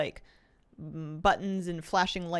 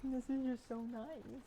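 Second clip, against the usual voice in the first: tender; 5 semitones higher, 9 dB quieter, slower than usual. Real, not acted. Soft remark about sleeping puppy.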